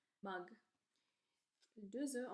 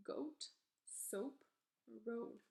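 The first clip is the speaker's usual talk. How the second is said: normal talking voice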